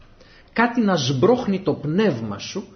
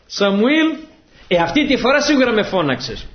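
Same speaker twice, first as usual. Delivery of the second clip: higher, louder call of «Σαμουήλ» then a sure claim; the child insists he was called; insistent call; 7 semitones higher, 5 dB louder than usual. Acted. Samuel insists a third time.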